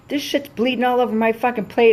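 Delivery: hammed-up rude customer; mock angry wife. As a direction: complain like an outraged diner.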